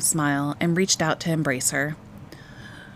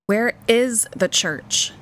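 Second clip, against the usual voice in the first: slow, higher-pitched insistent question; mildly raised voice, demanding an answer; asked loudly; 6 semitones higher, 4 dB louder, much slower than usual. A narrator's reading. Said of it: "I asked loudly".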